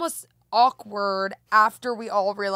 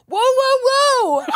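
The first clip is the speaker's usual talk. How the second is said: high, loud reenacted yell of alarm; yelling whoa; 13 semitones higher, 8 dB louder, much slower than usual. Pedestrian retelling how they yelled at the car, loud yelling. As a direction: cry out to stop an oncoming car. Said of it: "I go".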